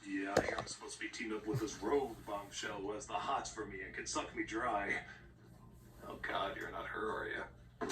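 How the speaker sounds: low put-on tough guy; lightly voiced gruff loner; gruff hero voice. Wolverine voiced in parody.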